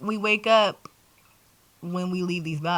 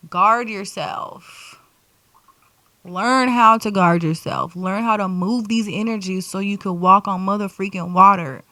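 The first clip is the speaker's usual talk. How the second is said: preachy emphasis; 9 dB louder than usual. Real, not acted.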